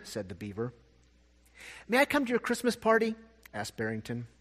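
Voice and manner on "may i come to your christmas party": a lightly voiced little bunny, raised higher; bunny asking hopefully, pitched up; small bunny voice. Barrington the bunny asks the beaver, lightly higher voice.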